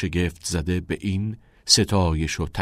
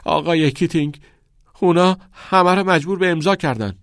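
tearful protest; 8 semitones higher, 5 dB louder than usual. A narrator's reading. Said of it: "اشک ریزان خواندن کمرون را قطع کرد".

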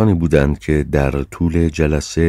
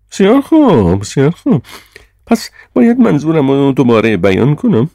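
very high, loud indignation; chairman's high-pitched, blunt denunciation; Sir John, outraged chairman; 10 semitones higher, 7 dB louder than usual. A narrator's reading. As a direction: hit back high and loud, with full disdain.

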